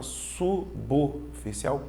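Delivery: "suboficial" with SU and BO held apart; syllable split. Acted. Student checking the odd separation with the professor, syllable split. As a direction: break "suboficial" after su and after bo. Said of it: "então a separação vai ficar".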